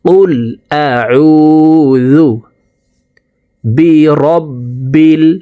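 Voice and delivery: stretched sung قل أعوذ برب; slow recitation demo. Shown as a demonstration. Teacher modelling for students to repeat. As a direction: chant each word long. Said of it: "আমার মতো বলার জন্য চেষ্টা করুন".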